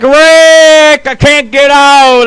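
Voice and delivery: high, loud mock wail; panicked cry for help. Imitating his buddy calling from the grave, panicked cry. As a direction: holler like a trapped, panicking buddy. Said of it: "He's like".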